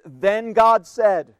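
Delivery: slow, loud, every word weighted; emphatic scripture phrase. Shown as a demonstration. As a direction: land "Then God said" like a proclamation. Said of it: "Note the repetition of the phrase".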